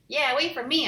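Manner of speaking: high, loud call after her; yell. Acted. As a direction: shout to be waited for. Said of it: "I yelled".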